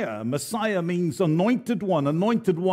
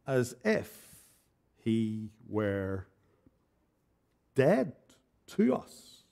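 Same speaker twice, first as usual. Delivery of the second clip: much softer, letting the words sink in; hushed sermon close; 6 semitones lower, 7 dB quieter, much slower than usual. Real, not acted. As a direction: drop almost to a hush on dead to us. Sermon point drops to hush.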